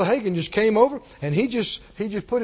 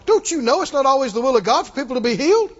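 raised, preachy scolding, hammed up; mock reprimand; 4 semitones higher, 4 dB louder than usual. Imagined reprimand of the healed woman, mock scolding. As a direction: scold like a pompous theology expert. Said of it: "so he could reprimand her".

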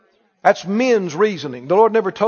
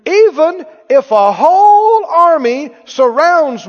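loud, high, drawn-out declaration; ringing preacher's Bible reading; proclaimed scripture; 8 semitones higher, 7 dB louder, slower than usual. Real, not acted. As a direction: build it big and slow, like a battle cry.

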